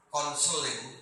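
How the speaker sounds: normal talking voice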